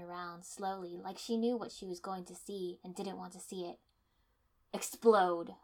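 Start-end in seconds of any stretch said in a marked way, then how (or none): 4.7-5.5 loud command. A narrator's reading. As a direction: bark the order. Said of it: "I commanded".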